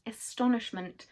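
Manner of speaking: plain reading voice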